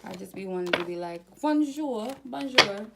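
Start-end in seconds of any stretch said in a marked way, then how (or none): 1.4-2.9 high, loud mock French bonjour; French accent. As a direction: channel a sudden French accent, playful. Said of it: "I feel like somebody has an accent".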